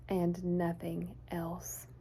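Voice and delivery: slow whispered ending; storyteller whispering the pencil's loneliness; hushed emptiness. A narrator's reading. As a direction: let it fade to a whisper.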